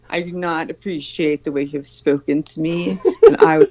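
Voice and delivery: lightly voiced prim formal complaint; a hint of a stuffy offended man; mocking a friend's polite tone, mildly prim; imitating prim complaint. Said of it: "I would like to say".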